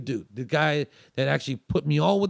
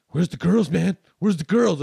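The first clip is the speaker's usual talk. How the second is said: louder insistent demand; mock insistent demand, louder; 3 semitones higher, 8 dB louder than usual. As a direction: press him, where are they. Host demanding the girls back.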